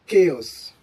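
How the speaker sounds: chaos as KAY-os, falling; hard K chaos. Shown as a demonstration. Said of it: "takes a hard key sound".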